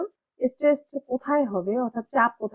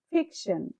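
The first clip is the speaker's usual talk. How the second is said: Fiction with ফিক stressed, শন falling away; stressed first syllable; 3 semitones lower, 6 dB quieter, slower than usual. Shown as a demonstration. Teacher joining the two syllables, stress on ফিক. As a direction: put the weight on ফিক in fiction. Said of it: "এখানে ফিক, তারপরে শন".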